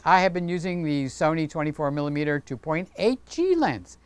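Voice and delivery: ordinary talk, nothing marked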